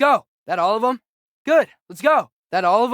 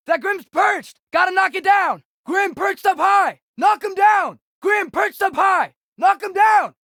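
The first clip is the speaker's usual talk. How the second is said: shouted orders; 6 semitones higher than usual. Acted. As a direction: bark the order across the battlefield. Shouting orders at a perched enemy.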